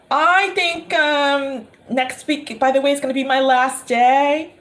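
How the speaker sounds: high, hesitant, mock-polite announcement; retold careful excuse; awkward resignation. Nanny quitting politely, retold.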